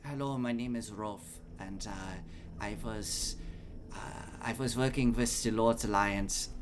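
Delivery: soft German-accented voice sample. Shown as a demonstration. Game master shows player Rolf's voice, soft German accent. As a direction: give a quiet, halting German accent. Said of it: "had, like, a German accent".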